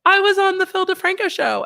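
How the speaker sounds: high thrilled imitation of a giddy text; mock excited news. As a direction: brag to friends with glee. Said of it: "if I texted my friends, like".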